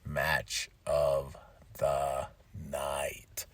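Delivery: hoarse low whisper, trailing off; smoker's rasp. Real, not acted. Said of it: "I sound like somebody that's, like, a hardcore smoker".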